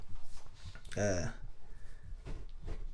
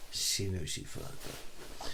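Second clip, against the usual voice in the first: slow, soft, almost whispered; hushed aside; 4 semitones lower, 9 dB quieter, much slower than usual. Real, not acted. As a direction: drop it to a knowing half-whisper. Aside about girlfriend's guilt.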